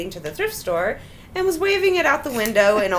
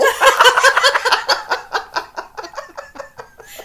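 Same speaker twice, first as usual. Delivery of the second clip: long high burst of laughter; helpless laughing fit; loud high laugh; 12 semitones higher, 5 dB louder than usual. Real, not acted. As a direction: crack up loudly. Big laugh.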